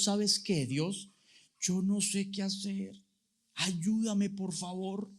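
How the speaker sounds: whisper-close plea for help; humble prayer. Retold or imitated. Pastor voices his own prayer. (oración suave y humilde)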